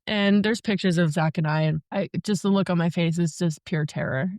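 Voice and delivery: ordinary talk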